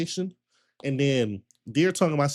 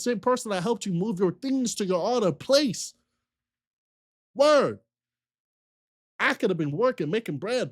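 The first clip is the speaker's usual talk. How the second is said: heated rant; 7 semitones higher than usual. Real, not acted. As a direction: pile it on, each line more outraged. Heated rant listing past favours.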